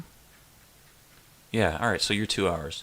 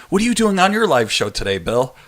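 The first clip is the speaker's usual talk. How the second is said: higher, louder put-on viewer voice; pretend viewer voice; 6 semitones higher, 3 dB louder than usual. Viewer asking Bill about his live show, higher put-on voice. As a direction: play the curious fan in the chat.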